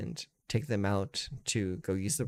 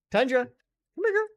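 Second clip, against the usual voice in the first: much higher and louder call; calling the dog; 7 dB louder than usual. Real, not acted. Owner calling Tundra the Great Pyrenees, loud high call. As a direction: call her across the room, high and bright.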